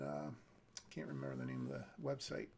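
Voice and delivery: normal talking voice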